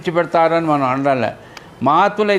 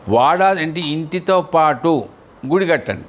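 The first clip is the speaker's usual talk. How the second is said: slow, each word spaced, వాడా stressed; slowly and carefully. Shown as a demonstration. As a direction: spell out వాడా అంటే ఇంటితో పాటు గుడి కట్టండి slowly for the listeners. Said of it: "నెమ్మదిగా". (నెమ్మదిగా, జాగ్రత్తగా వినేలా)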